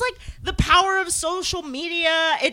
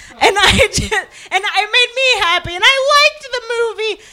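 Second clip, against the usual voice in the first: higher, louder, laughing gush; gushing happily; 7 semitones higher, 5 dB louder than usual. Real, not acted. Podcaster remembering a happy movie night, gushing happily. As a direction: burst out with delighted affection.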